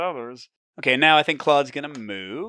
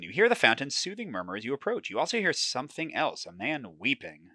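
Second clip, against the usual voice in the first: steady storyteller read; moody game narration; story narration; 2 semitones higher, 5 dB quieter than usual. A narrator's reading. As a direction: draw the listener into the fog. Reads the scene aloud.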